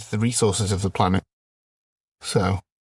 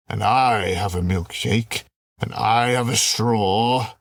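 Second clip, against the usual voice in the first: gloating film villain; slower than usual. Acted. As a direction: savour the threat like a movie baddie.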